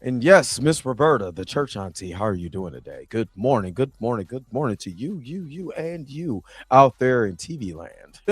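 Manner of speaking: hammed-up radio announcer welcoming the audience; professional radio voice. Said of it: "my professional radio voice going on".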